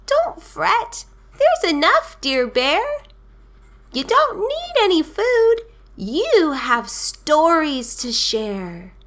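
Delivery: very high little mouse voice; tiny, high-pitched storybook mouse; squeaking mouse. A narrator's reading. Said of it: "Mouse squeaks".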